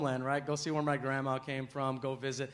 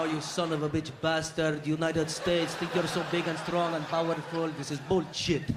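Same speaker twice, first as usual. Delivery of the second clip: put-on Arabic-accented English cursing; 2 semitones lower than usual. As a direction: grumble threats in a heavy Arabic accent. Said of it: "Arabs love to cuss in English".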